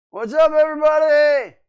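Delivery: big shouted hello to the stream; loud greeting. Real, not acted. Host shouting hello to the stream chat, loud and high.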